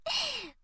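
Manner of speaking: quiet high giggle that falls away; soft giggle. Acted. Big sister giggling as the bet is settled, soft high laugh. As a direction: giggle under your breath.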